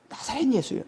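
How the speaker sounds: usual speaking voice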